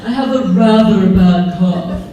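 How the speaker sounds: low, loud, posh-sounding overdone delivery; pretentious formal. Retold or imitated. Mocking his own text as a pompous aristocrat. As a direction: lord it over them pompously. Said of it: "Could I be any more pretentious?".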